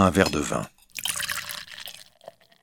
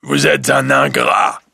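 slow, loud monstrous growl, pitched up; terrible voix de bête; 7 semitones higher, 5 dB louder than usual. Acted. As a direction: thunder it out like a furious beast. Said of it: "dit la bête d'une terrible voix". (voix terrible et lente de la bête, criée)

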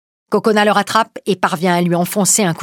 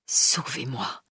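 the repeated plea breathed out as a whisper; fading whisper; 4 semitones lower, 9 dB quieter, much slower than usual. Acted. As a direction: life slipping away as he begs.

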